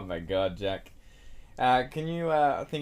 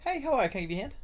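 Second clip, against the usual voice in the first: high sing-song shop greeting; customer service voice; 12 semitones higher, much faster than usual. Shown as a demonstration. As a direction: lift it all up high and sweet. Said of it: "it's all up high".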